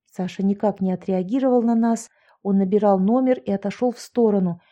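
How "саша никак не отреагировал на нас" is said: plain reading voice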